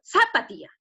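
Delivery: "zapatilla" with ZA punched, the rest falling away; wrong stress on ZA. Shown as a demonstration. Teacher testing the wrong syllable, stress on ZA in "zapatilla". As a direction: hit the first syllable of "zapatilla". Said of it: "está en sa".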